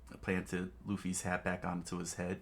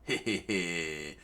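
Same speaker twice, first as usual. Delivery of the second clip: cheesy grin; much slower than usual. Acted. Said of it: "his trademark cheesy smile".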